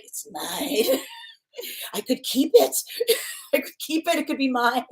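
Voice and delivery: high, laughing mock possessiveness; possessive Scorpio. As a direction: play a covetous Scorpio through giggles. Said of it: "We've all met a Scorpio like that".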